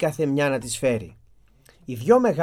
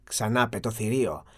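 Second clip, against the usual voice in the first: plain reading voice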